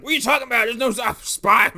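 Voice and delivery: loud high panicked denial; flustered high yell; Tex yelling. Retold or imitated. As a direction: shout it like a cornered spy. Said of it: "He's like".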